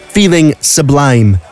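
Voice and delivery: usual speaking voice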